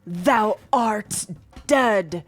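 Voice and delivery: loud archaic declaration; old-fashioned speech. Retold or imitated. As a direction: proclaim it like a knight. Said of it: "I suddenly said".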